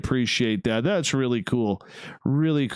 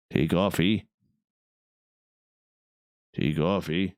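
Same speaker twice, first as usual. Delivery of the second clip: put-on Canadian accent; 6 semitones lower than usual. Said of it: "so Canadian right now".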